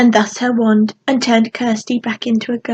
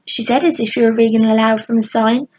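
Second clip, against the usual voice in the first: plain reading voice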